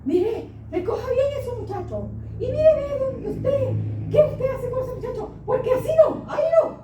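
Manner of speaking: high, loud scolding, half laughing; mock scolding. Retold scolding of a neighbor. (regaño fingido, agudo y fuerte)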